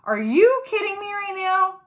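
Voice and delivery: put-on annoyed kid glaring at her dad; exasperated disbelief. A narrator's reading. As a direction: throw the question at him in disbelief. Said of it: "a glare that asks".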